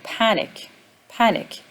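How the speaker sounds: "panic" as PAN-ic; stress on first syllable. Shown as a demonstration. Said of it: "The first syllable is stressed".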